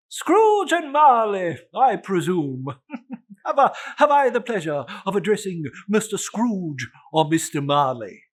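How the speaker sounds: high, loud, jovial gentleman voice; portly gentleman. Acted. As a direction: play the cheerful charity collector, bowing.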